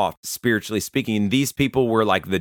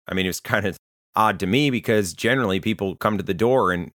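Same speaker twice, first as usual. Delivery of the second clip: normal talking voice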